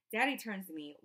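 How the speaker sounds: plain reading voice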